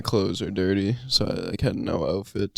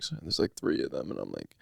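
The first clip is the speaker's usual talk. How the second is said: normal talking voice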